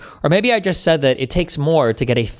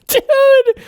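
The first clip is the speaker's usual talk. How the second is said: high, squealed laughing exclamation; laughing outburst; 4 dB louder than usual. Real, not acted. Streamer cracking up at a debate opponent's jab, helpless high laughter. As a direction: crack up and cry out, much higher.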